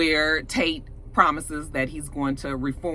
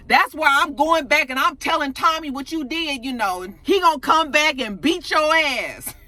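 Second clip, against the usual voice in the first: high-pitched, worked-up threat; defiant threat; 8 semitones higher than usual. Retold or imitated. Retelling her threat to tell Tommy, defiant. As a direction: act out an indignant tattletale threat, pitch up.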